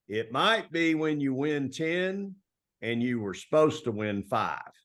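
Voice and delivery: slow, drawn-out Texas coach twang; a hint of the old mentor's slow Texas twang. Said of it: "he talks real slow and he's got a big twang".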